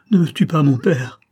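loud, high desperate plea; a daughter begging her father to spare her; pleading. A narrator's reading. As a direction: beg him for your life. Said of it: "supplia la malheureuse".

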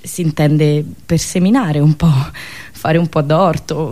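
ordinary talk

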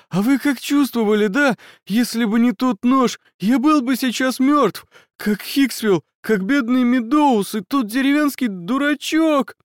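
high, near-sobbing terrified babble; terrified babbling. Acted. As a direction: babble in terror, near tears. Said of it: "Он всхлипнул от ужаса".

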